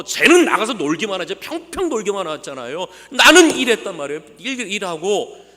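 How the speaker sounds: resentful son shouting at his father; angry shout. Retold or imitated. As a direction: yell the grievance at his father. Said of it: "아버지가 소리를 지면서".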